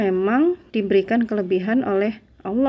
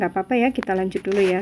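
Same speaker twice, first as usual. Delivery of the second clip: ordinary delivery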